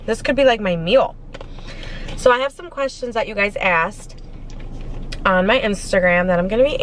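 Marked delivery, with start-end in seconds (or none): none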